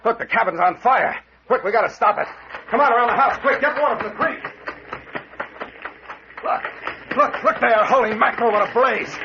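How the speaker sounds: panicked high shouts at a burning cabin; alarmed shouting. Acted. Cabin found on fire.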